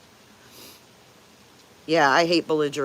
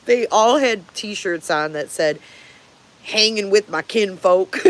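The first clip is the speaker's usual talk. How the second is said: laughing, louder; 3 semitones higher, 3 dB louder, slower than usual. Real, not acted. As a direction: giggle through the retelling. Laughing at redneck shirts.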